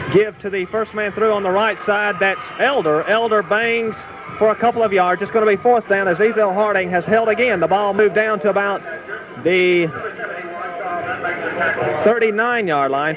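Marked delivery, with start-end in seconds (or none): none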